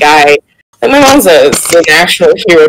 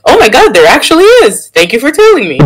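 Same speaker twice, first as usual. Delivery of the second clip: mom's casual surprise; 8 semitones higher, faster than usual. Retold or imitated. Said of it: "She's like".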